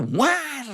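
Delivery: high, long startling bray; imitated donkey bray. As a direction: let out a sudden donkey honk. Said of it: "lewat itu nggak ada apa". (tiruan ringkikan keledai tiba-tiba)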